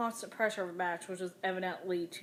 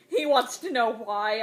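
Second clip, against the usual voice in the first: loud furious scream; mother screaming at her friend in rage; 3 semitones higher, 10 dB louder than usual. A narrator's reading. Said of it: "I screamed at her".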